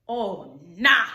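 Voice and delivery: low "Oh", then a loud punched "nah"; a sudden sharp "nah" at the cold; oh nah exclaimed. Retold or imitated. Creator at the door feeling the cold, loud "nah". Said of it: "I say".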